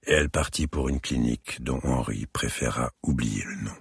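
usual speaking voice

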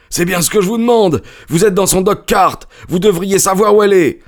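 high, fast, agitated voice; agitated retort. A narrator's reading. Stranger retorting to Holmes, agitated. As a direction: fire it back in alarm.

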